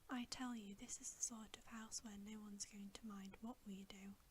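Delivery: ordinary delivery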